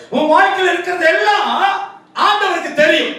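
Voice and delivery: high-pitched, drawn-out preaching list; impassioned preaching. Real, not acted. Preacher telling the congregation God knows everything, impassioned preaching.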